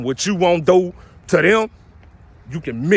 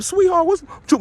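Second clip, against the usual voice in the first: mock boyfriend. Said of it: "He like".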